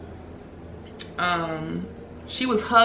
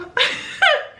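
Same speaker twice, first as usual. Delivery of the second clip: high-pitched burst of laughter; real laugh. Real, not acted. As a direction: laugh out high at a friend's silliness. Laughs at the crying friend.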